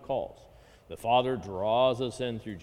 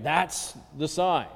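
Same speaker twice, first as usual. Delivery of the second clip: normal talking voice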